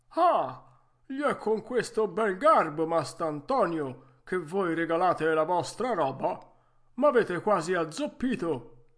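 vecchietto indignato, forte e più acuto; protesta dolorante. Acted. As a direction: lamentati a gran voce del colpo. Geppetto colpito agli stinchi protesta. (protesta indignata e dolorante)

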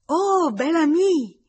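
high, loud, drawn-out gleeful cry; delighted child's shout. A narrator's reading. Girl runs to greet him clapping, shouting with delight. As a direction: shout it like an overjoyed little girl. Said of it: "battendo le mani e gridando".

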